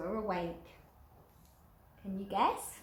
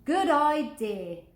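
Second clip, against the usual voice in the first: louder, slow hearty agreement; big skeleton agreeing; 6 semitones higher, 9 dB louder, slower than usual. Acted. Big skeleton agreeing to scare someone, louder and slow. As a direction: draw out your approval. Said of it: "the big skeleton said".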